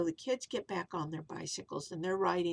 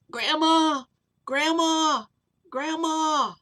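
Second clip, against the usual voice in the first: high, louder falling calls; calling out; 7 semitones higher, 10 dB louder, slower than usual. Acted. Kids searching near town, calling loudly. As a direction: call her name across the town.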